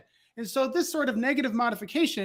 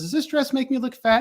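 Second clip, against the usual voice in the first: lightly voiced girlfriend, pitched up; 6 semitones higher than usual. Girlfriend asking about the dress, higher mock voice. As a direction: ask it higher, as the girlfriend in the example. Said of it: "She goes".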